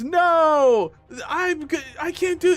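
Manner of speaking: high, loud panicked cries; imitated panic. Streamer imitating the victim's last panicked cry. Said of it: "he went".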